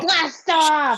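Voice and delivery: loud blast-off shout; rocket launch roar. Pretend. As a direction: call it out like a launch. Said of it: "you'll say blast off, then we'll make rocket noises".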